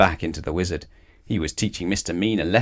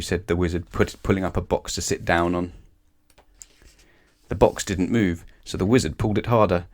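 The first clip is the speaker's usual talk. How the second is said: plain reading voice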